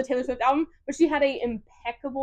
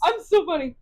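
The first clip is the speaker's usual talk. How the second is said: ordinary delivery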